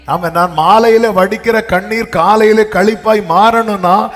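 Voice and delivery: high, loud, building sermon climax; full-force preaching. Real, not acted. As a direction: drive the point home to the congregation.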